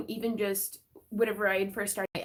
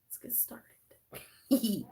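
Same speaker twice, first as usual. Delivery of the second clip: whisper; much slower than usual. Pretend. Playful whisper to viewers.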